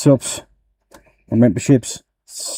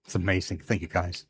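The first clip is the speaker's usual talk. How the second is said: very soft, moved almost to tears; tearful gratitude; 4 semitones lower, 10 dB quieter than usual. Real, not acted. Streamer moved by viewers' generosity, tearful gratitude. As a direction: thank them quietly, holding back real tears. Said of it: "you did put tears in my eyes".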